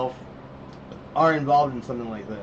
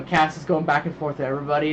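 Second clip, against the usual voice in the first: ordinary talk, nothing marked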